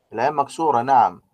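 usual speaking voice

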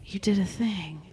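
hushed retort under the breath; whispered comeback to a rude stranger. Retold or imitated. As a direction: mutter it back, barely voiced. Retold retort continues.

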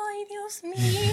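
high, slow, breathy-sounding dismay; mock terrified sigh; a frightened little cry of dismay; scared gasp. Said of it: "y yo".

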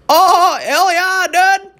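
very high, hollered surfer-dude voice; hammed-up mocking surfer yell; surfer bro. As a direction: whoop like an over-stoked surfer. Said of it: "Like a surfer bra".

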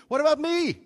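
high, loud, outraged question; indignant cry. Retold or imitated. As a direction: throw it up at heaven. Anguished cry in retold prayer.

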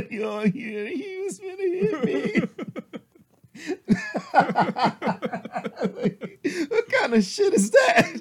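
high, slow fake sob with laughter; hammed-up fake crying; mock crying. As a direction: whine like a wronged victim, laughing. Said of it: "mock crying victim".

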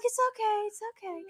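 high, soft soothing voice as the nurse; a nurse calming a patient who soaked the floor, soft high reassurance. Retold or imitated. Nurse soothing the embarrassed mother, high and gentle. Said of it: "She's like".

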